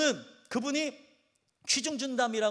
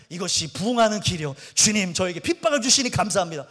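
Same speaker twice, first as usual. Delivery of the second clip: mock zealous prayer; 2 semitones lower, 7 dB louder than usual. Pastor's past self praying zealously.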